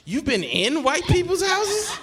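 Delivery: high, slow, drawn-out question; incredulous mom. Retold or imitated. Mom's disbelief, acted.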